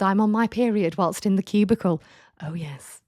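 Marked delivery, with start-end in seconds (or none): 2.4-3.0 breathy mock-conspiratorial whisper of oh yes. Host whispering a knowing aside to listeners.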